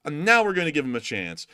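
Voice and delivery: heated rant at the president; worked-up rant. Real, not acted. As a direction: rant about it, fired up. Ranting about the president.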